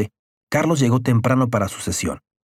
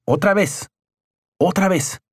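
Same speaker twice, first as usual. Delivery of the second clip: higher, insistent command; urging shout; 8 semitones higher than usual. A narrator's reading. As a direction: drive him on to yell again. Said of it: "Grita alto". (orden insistente, más aguda)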